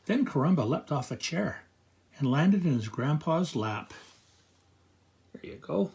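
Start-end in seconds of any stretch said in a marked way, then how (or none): 5.3-5.9 soft unvoiced whisper. Real, not acted. Aside to child listener.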